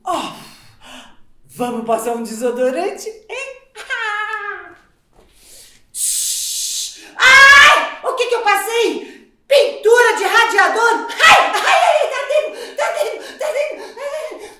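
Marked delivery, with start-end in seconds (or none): 3.8-14.5 very loud, much higher yelp; comic scream. Acted. Mother sprayed with radiator paint, loud high yelp. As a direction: yelp in pained alarm.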